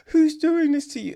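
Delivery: high, loud tearful gasp; hammed-up teary outburst; mock crying. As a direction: wail it like an overacting actress. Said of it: "she's basically crying, going".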